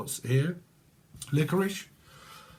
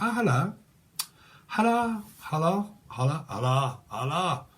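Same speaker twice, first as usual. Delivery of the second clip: posh upper class; 3 semitones higher than usual. Pretend. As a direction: greet like a toff. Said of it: "upper class".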